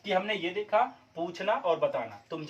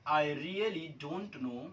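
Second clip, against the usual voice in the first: slow dictation; 3 semitones lower, 5 dB quieter, much slower than usual. Shown as a demonstration. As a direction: dictate the sentence slowly. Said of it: "कैसे बनाएंगे guys". (धीरे-धीरे लिखवाना)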